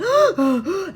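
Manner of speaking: sharp, loud, high gasp for air; panicked gasp. Retold or imitated. Reliving her panic attack at the gate with a loud gasp. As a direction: gulp air like you can't breathe. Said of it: "I really couldn't breathe".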